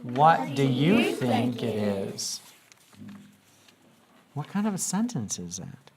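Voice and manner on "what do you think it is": very slow, every word spaced out; slow group repetition of a question; slow choral reading. Shown as a demonstration. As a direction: lead the class through it slowly. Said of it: "Let's all say it together".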